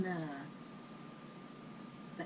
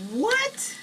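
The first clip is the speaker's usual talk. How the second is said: shocked what; 11 semitones higher, 4 dB louder than usual. Retold or imitated. Hearing about the woman in the shower.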